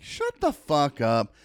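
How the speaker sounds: slow, stretched-out "Shut the fuck up"; incredulous, slowly spaced words; drawn-out disbelief. Retold or imitated. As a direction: stretch each word in disbelief. Repeated disbelief, more emphatic.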